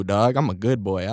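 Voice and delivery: usual speaking voice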